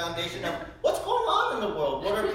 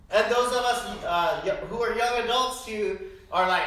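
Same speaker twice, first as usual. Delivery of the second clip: normal talking voice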